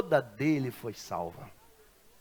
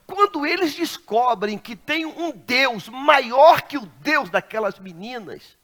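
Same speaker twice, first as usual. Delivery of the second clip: preaching at full force; 7 semitones higher, 6 dB louder, slower than usual. Real, not acted. Preaching builds to climax.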